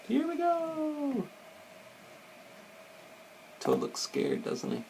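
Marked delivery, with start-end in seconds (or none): 0.1-1.3 very high, drawn-out small cry; thin falsetto whoop, held long without volume; mock excited squeal. A narrator's reading. Replays Frog's call over the picture.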